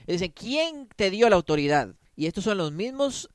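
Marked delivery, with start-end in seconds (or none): 0.3-1.9 loud, high indignant challenge; mocking the scribes' challenge; scribes challenging. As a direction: demand it like a haughty scribe. Preacher voices challenging scribes.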